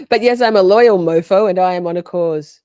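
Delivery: loudly declaring loyalty; proud loud declaration. Retold or imitated. Streamer reading viewer message out, loud and proud. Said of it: "Scott says".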